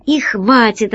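plain reading voice

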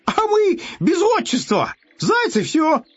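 very high, gleeful hare; merry shout. A narrator's reading. Hare has no patronymic, shouts it merrily and high. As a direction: cry out cheerfully, pitch way up. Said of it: "вдруг весело крикнул".

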